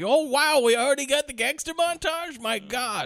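high-pitched, hammed-up astonishment; mock amazement. Podcaster imitating a viewer surprised by early montage, mock amazement.

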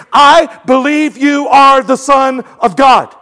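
ringing profession of faith; emphatic declaration. Retold or imitated. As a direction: declare it with conviction, word by word. Preacher voices Bartimaeus's declaration of faith.

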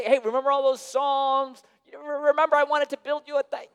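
high, fast, show-off voice; mock David boasting to God; bragging David. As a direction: brag about old victories like a hammed-up hero. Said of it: "He doesn't say".

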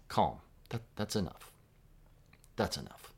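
put-on soft, settling voice, calming a child down; gently hushing a little one; soothing a child. Preacher voicing Jesus calming a child, soft and settling. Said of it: "like speaking to a child".

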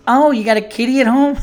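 high, loud gushing mock girl's voice; over-the-top cashier. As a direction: imitate an overexcited young cashier. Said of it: "this girl was so over the top".

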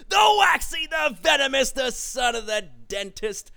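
high, loud nickname roll-call; wrestling ring announcer. Pretend. Host introducing the next co-host, booming ring announcement. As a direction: proclaim each title like a ring entrance.

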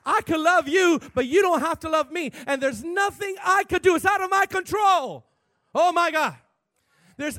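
loud, high, forceful preacher; impassioned preaching. Acted.

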